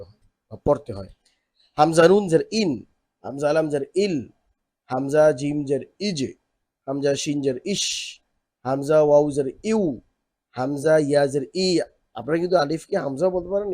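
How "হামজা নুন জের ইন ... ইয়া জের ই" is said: drill recitation. Shown as a demonstration. Teacher recites letter drill.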